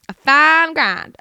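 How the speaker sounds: put-on loud, high, drawn-out twangy accent; put-on twang. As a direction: stretch it out in a mock twangy accent. Repeats phrase in put-on twang.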